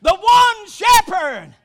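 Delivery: loud, high shouted preaching with held-out line endings; full-force preaching. Real, not acted. Preacher thrilled to be carried home, full-force shouted preaching. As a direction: preach it in driving phrases, stretching the last word.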